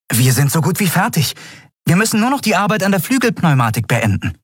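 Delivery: put-on high boyish voice, quickening; eager young boy. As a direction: report keenly in a youngster's high voice.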